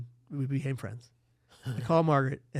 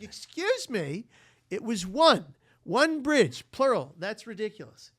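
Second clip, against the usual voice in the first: high, slow, indignant correction; mock indignant protest; 7 semitones higher, 7 dB louder, slower than usual. Narrator correcting the cousin about the bridges, high mock indignation. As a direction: play up the offended nitpick.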